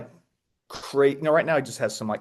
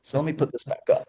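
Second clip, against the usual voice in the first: ordinary talk, nothing marked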